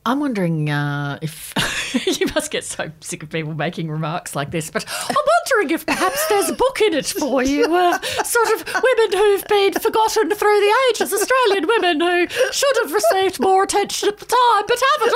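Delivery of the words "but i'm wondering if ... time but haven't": lightly voiced meddling old lady, raised pitch; a hint of a prim interfering old lady; Vera Mackenzie voice. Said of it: "I love when you take on that voice".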